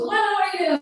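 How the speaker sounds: high-pitched baffled question; mock bewilderment. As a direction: imitate a puzzled stranger. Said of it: "he's so confused".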